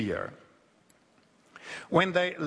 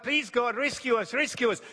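pleading cry; 8 semitones higher than usual. Acted. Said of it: "they cried out".

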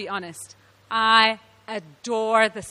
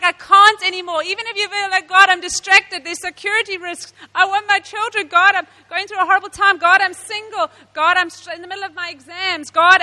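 louder, higher mock lament to God; complaining to God; 5 semitones higher, 5 dB louder than usual. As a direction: pour out every excuse to God, hammed up.

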